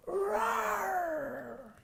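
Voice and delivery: squealed roar held long; a small child's pretend roar; high roar. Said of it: "roar as loud as you can".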